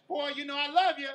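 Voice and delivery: loud, teasing sass; trash-talking auntie. Retold or imitated. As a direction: tease him like a sassy auntie. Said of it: "that one auntie that's always talking trash".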